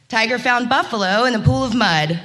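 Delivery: usual speaking voice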